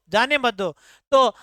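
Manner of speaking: ordinary talk, nothing marked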